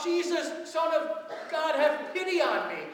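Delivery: loud, high desperate cry for mercy; scream out. Retold or imitated. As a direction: cry out to be heard over the crowd. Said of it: "he screams out".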